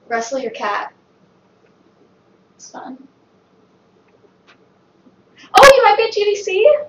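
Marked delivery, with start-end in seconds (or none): 2.6-3.1 soft whispered aside; whispering. Real, not acted. 5.5-6.8 high loud quick excitement; excited. Real, not acted. Excited realization.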